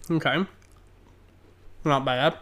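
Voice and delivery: chewing while talking; mouth full. Real, not acted. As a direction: talk through a bite of cookie. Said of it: "I apologize if you can hear me chewing".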